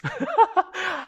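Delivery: sudden high burst of laughter; a bright amused laugh breaking in; real laugh. Real, not acted. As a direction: laugh out at yourself. Laughs at own experience.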